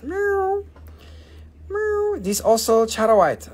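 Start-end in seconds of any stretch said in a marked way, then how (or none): 0.0-2.2 high mock meow; squeaky playful kitty meow; cat meow. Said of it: "it is kitty cat".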